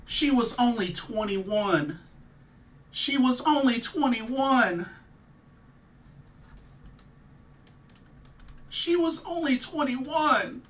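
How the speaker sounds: slow, high and loud, voice straining with grief; keen it out in real pain; a pained wail rising each time; grieving lament. Real, not acted. Grieving a young wrestler's death.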